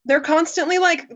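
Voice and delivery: ordinary delivery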